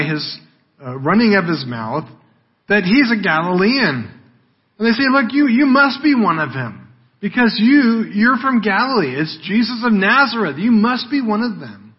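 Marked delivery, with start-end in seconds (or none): 5.1-11.9 higher, pointed accusing; insistent accusation. Acted. Bystanders confronting Peter, insistent accusation. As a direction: press the charge as if catching someone out.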